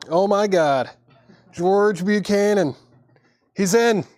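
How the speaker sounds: high, loud thrilled outburst; excited. Real, not acted. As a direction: blurt it out, thrilled by the bid. Big bid lands in auction.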